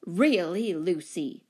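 loud, commanding rebuke; booming. A narrator's reading. Large commanding lady rebuking her neighbour, booming. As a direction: boom it out. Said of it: "exclaimed in a booming voice".

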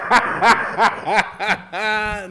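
loud burst of high laughter; real laughter. Real, not acted. Co-host reacting to Ole Miss joke, laughing. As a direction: crack up in a high, rolling laugh.

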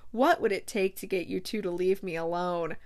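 normal talking voice

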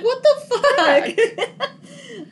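high, drawn-out incredulous cry; hammed-up flabbergasted reaction; mock disbelief. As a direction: stretch it out in laughing disbelief. Retelling own disbelief at plot.

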